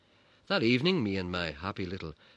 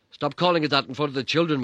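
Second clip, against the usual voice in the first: loud, higher sharp retort; dad retorting; 8 semitones higher, 11 dB louder than usual. Retold or imitated. Dad retorting to his wife before the children, loud. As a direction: scold her in front of the kids. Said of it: "my dad retorted".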